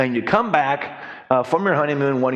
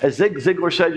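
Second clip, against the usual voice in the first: ordinary delivery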